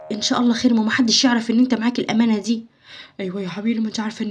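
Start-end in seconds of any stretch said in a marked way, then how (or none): 3.2-4.3 hushed. Acted. Hushed secret talk.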